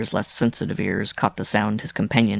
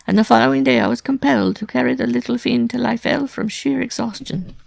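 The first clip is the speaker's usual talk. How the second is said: high, loud, aggrieved woman's voice; plaintive wife; 11 semitones higher, 5 dB louder than usual. A narrator's reading. Wife recounting her failed escape to Simon, plaintively. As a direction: recount the ordeal in distressed high pitch.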